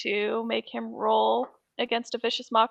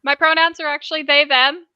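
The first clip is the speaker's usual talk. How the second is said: quick, louder character voice; tiefling voice; 3 semitones higher, 7 dB louder than usual. Acted. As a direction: voice the tiefling correcting pronouns. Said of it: "And that tiefling goes".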